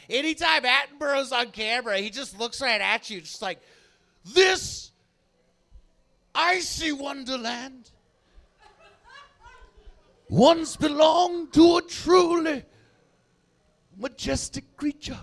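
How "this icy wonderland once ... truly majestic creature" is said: slow, solemn documentary narration; David Attenborough impression. Comic mimicking Attenborough for the crowd, hushed and grave. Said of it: "Why does he say it like the ghost of Christmas future".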